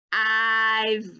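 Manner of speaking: "I've" with a long, level I; stretched sounds. Shown as a demonstration. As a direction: draw "I've" out into its v. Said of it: "stretching the word out".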